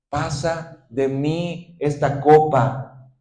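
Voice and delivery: drawn out very slowly, heavy with grief; slow tearful prayer of "Pasa de mí esta copa"; slow anguished plea. Acted. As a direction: let each word of "Pasa de mí esta copa" hang in sorrow. Con ruegos y lágrimas.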